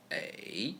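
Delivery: third tone A. Shown as a demonstration.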